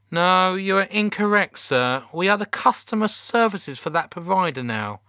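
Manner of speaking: high, officious correction; teenage call-centre girl. A narrator's reading. Teenage call handler corrects the shouting captain, high and officious. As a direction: correct him like a jobsworth teen. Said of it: "who sounded in her teens".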